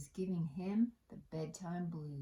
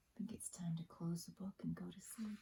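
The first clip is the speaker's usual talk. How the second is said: soft bedtime whisper; 8 dB quieter, faster than usual. Real, not acted. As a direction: hush it down as the story ends. Storyteller hushes for bedtime.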